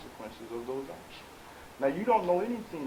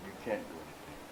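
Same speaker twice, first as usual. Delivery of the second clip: usual speaking voice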